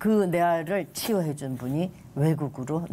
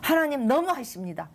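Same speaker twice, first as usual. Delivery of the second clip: retold high-pitched plaint; tearful reproach; 8 semitones higher than usual. Complaining to God as the healer leaves, tearful reproach. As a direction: lift the pitch into a mild wail of protest. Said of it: "엉엉 울었어요".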